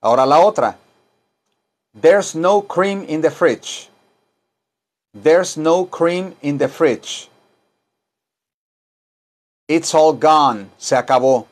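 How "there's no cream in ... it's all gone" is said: very slow, words drawn out; slow model sentence. Shown as a demonstration. Teacher models slowly for class to repeat.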